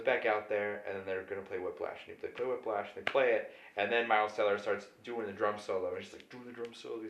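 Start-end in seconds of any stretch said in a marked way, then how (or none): none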